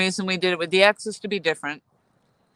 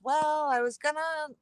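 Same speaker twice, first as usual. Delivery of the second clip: higher-pitched mock start of a long answer; imitating a rambling opener; 10 semitones higher than usual. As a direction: imitate her launching into a long-winded story. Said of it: "she started out with".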